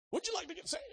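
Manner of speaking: very high, silly sing-out voice; pushy evangelist parody. Acted. Friend's imagined pitch to crying driver, high mock-evangelist voice. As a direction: put on a squeaky, over-eager pitch.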